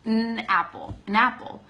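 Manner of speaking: "napple" with the n held through the nose; linked n. Shown as a demonstration. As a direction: hum the n into "apple". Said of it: "It's a nasal sound".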